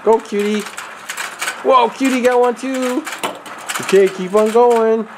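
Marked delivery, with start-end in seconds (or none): none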